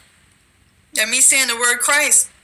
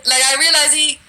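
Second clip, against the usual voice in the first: ordinary talk, nothing marked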